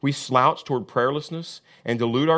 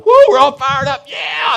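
shouted team whoop, much higher and louder; mock fired-up shout; 12 dB louder than usual. Believers charging out the tunnel, shouting whoops. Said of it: "like that team that runs out of the tunnel".

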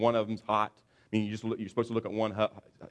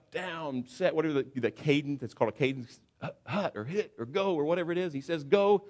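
a hint of a quarterback barking the cadence, higher; cadence call; 6 semitones higher than usual. Preacher voicing the quarterback at the line, mild bark. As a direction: bark out the signals.

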